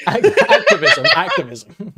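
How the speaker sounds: word squeaked high through real laughter; laughing correction. Real, not acted. Guest correcting a misheard Activision as activism, cracking up.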